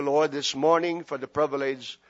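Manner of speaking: usual speaking voice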